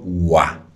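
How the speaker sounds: "ua" sounded out alone, loud, as French OI; sound demonstration. Shown as a demonstration. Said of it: "la O y la I hacen el sonido".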